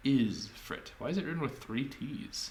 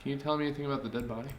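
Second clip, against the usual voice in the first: ordinary talk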